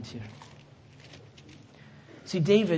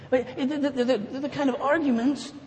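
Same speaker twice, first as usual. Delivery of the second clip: laughing; 8 semitones higher than usual. Real, not acted. Laughs at his own marriage.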